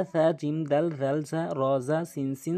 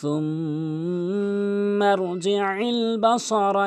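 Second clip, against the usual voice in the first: slow, higher, drawn-out tajweed chant; Quran recitation; 6 semitones higher, 4 dB louder, much slower than usual. Shown as a demonstration. Teacher recites the verse for learners, measured Quran recitation. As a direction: recite the verse with long held vowels.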